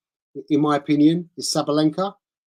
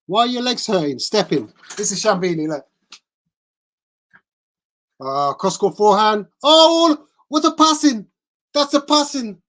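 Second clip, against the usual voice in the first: impression of Shambini; 5 semitones higher, 3 dB louder than usual. Streamer calling a rally to his viewers, in a high, excited impression. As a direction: gush over a passing shot, then wander off for pizza. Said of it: "That's my impression of Ciambini".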